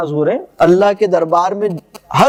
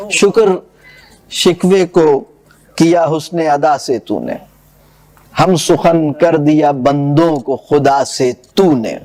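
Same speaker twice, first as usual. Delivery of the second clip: measured, lower verse recital; poem recitation; 5 semitones lower, slower than usual. Acted. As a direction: recite the couplets with gravity. (شاعرانہ انداز میں پڑھت)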